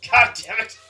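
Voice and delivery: very loud cry of god damn it; yelling curse, half-joking. Real, not acted. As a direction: shout it out in mock frustration.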